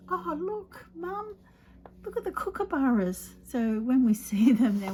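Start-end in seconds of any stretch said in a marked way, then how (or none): 0.1-3.3 put-on high, excited child's voice calling to mum; imitating a small child, pitched up and eager; little kid. Mum retells a kid at the lake pointing out birds, in a high childlike voice. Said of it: "a little kid goes".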